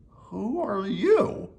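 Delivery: high, drawn-out astonishment; gasped. A narrator's reading. Friends find a furry stranger on the doorstep, gasped surprise. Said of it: "everyone gasped".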